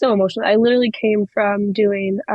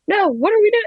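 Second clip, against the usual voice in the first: much higher, insistent question; acted frantic confusion; 12 semitones higher than usual. Wrestler asks her opponent what's happening, acting frantically confused. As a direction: replay a panicked mid-match query. Acts confused exchange on ramp.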